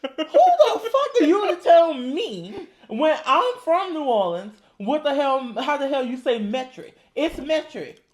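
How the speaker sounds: high, loud New Orleans outburst; indignant New Orleans clapback, played for laughs; full New Orleans. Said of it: "He went straight New Orleans on y'all".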